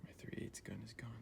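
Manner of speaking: breathy hush over a missing tool; whisper. Real, not acted. Farmer whispering to himself about his missing gun. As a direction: whisper it to yourself, dismayed. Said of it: "I don't know why I'm whispering".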